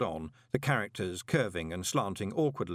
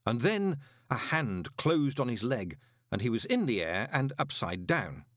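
plain reading voice

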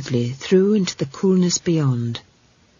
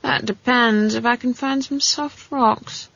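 put-on absent-minded old granny, slightly higher; distracted, drawn-out granny reply; preoccupied; 6 semitones higher than usual. A narrator's reading. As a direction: answer while busy with something else. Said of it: "in a preoccupied voice".

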